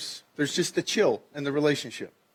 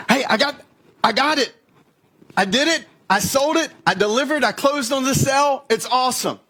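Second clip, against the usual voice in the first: much higher and louder, triumphant; excited coworker; 9 semitones higher, 4 dB louder than usual. Acted. Coworker bursts in, crowing about closing the sale. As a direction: celebrate the closed sale. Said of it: "Your coworker".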